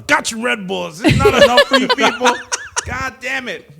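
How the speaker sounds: high, loud comic outburst; hammed-up angry rant, pitched high; mock outrage. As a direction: yell at your staff in pretend exasperation.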